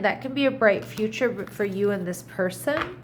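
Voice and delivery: normal talking voice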